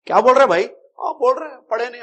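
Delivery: what are you saying, brother, said with outrage; indignant rebuke. Real, not acted. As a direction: call out the nonsense with scorn. Preacher rebukes a false claim.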